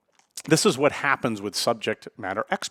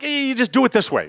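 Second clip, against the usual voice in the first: high-pitched impatient mock outburst; frustrated; 11 semitones higher than usual. Frustrated expert snapping at a trainee, impatient and high. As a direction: act out losing patience with a learner. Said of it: "they're getting frustrated".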